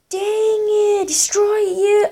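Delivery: high-pitched, drawn-out frustration; frustrated outburst. Real, not acted. Player getting destroyed in the game, high frustrated cry.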